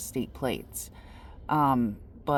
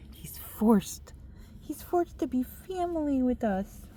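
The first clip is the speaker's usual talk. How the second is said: higher, whispered then cutesy pet talk; 9 semitones higher, 4 dB quieter than usual. Real, not acted. Teasing about baby Frankie living in the car, cutesy. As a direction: coo it fondly.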